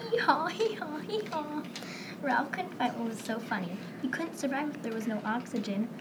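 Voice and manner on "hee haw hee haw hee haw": higher braying hee-haw laugh; a unicorn laughing like a donkey. Acted. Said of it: "sounded like a donkey".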